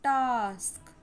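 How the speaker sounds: "टास्क" with a long, falling aah; drawn-out, clear "टास्क"; long A demo. Shown as a demonstration. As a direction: stretch the vowel in "टास्क". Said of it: "long sound".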